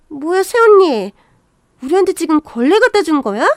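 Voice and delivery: loud, indignant sister-in-law; temper flaring. Acted. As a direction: accuse her loudly, offended. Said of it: "성질 부리는".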